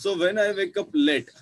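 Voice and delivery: very slow, deliberate recitation; slow poem reading. Shown as a demonstration. Teacher recites poem line to pupils, slow and clear. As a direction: read each word out slowly for the class. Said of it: "teacher reads poem line to class".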